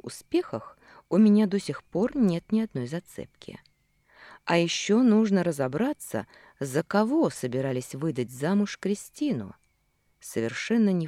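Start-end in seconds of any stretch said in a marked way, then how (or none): none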